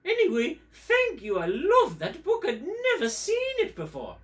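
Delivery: very high, loud English puppet voice; English accent. Acted. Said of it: "I'm from England".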